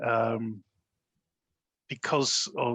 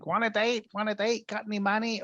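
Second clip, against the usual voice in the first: much higher, hammed-up calling out to passers-by; mock streetwalker call-out; 10 semitones higher than usual. Retelling the film, the hustler calls to men in a high mock yell.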